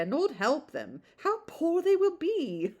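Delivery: gossiping matron, mildly put on; gossipy old lady. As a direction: rattle on like a busybody matron. Mrs Jennings voiced gossiping.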